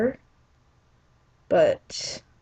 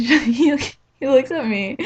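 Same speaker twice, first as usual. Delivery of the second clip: ordinary talk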